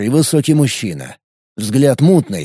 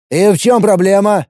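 sharp, annoyed, higher question; irritated; 8 semitones higher, 4 dB louder than usual. Acted. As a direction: snap it out impatiently. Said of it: "В голосе Боба звучало раздражение".

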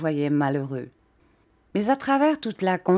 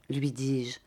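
ordinary delivery, nothing marked

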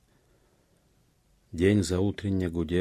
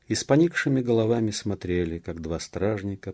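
plain reading voice

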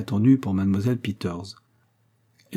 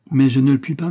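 raised, louder, emphatic protest; energetic refusal; 4 semitones higher, 7 dB louder than usual. A narrator's reading. Agnès turning down monsieur Stephenson, energetically. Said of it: "dit Agnès avec une vive énergie".

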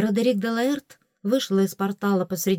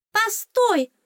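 very high squeaky call; fairy shouting; 14 semitones higher, 4 dB louder than usual. A narrator's reading. Fairy stops departing heroine, high cry. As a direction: cry out to stop her in a tiny voice. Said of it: "крикнула оскалка".

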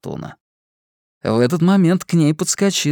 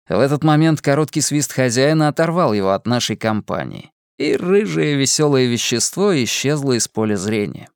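ordinary delivery, nothing marked

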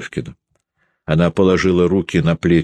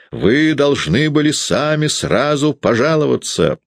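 threatening; 8 semitones higher than usual. A narrator's reading. Peredonov threatening Sasha with punishment, menacing. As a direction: threaten the boy with glee.

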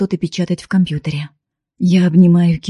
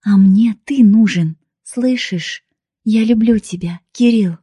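raised-pitch, heartfelt insistence; insistent pleading; 4 semitones higher, 3 dB louder than usual. A narrator's reading. Woman declaring love to the man rejecting her, pleading. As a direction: press your love on him.

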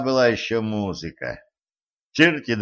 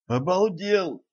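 stunned; 9 semitones higher, 3 dB quieter, slower than usual. A narrator's reading. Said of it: "выпучил глаза Ланс".

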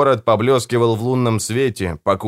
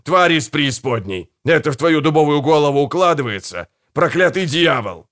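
higher, exasperated outburst; heated, emphatic ranting; exploding in anger; 5 semitones higher than usual. A narrator's reading. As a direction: hammer it into a thick skull. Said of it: "взорвался Гегель".

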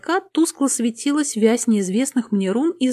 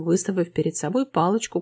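ordinary delivery

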